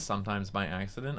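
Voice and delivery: usual speaking voice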